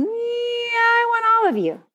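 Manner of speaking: slow, high, gentle call; tender divine voice. Pretend. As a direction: draw them in lovingly, unhurried. Voicing the Lord's gentle call.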